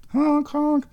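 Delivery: high squeaky honking; car horn honk. Pretend.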